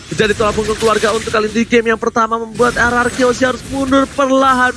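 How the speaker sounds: ordinary delivery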